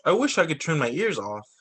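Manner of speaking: plain reading voice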